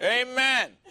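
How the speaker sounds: high, loud mock "amen"; wife's amen. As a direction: belt it out, imitating an exclaiming wife. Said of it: "saying that very same thing".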